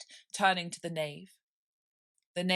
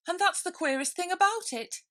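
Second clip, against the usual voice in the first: high, flustered rabbit voice; 13 semitones higher, 7 dB louder than usual. Acted. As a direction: squeak it out in a hurry.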